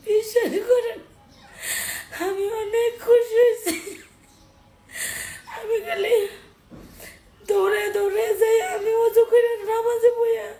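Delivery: real tearful wailing; crying. Real, not acted. Tearfully telling viewers about running to pray, crying. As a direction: speak through sobs. Tearful account of praying while helpless.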